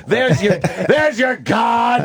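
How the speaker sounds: high, slow gloating jeer; mocking taunt. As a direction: taunt him, drawn out and high. [name] taunting Voss after fart.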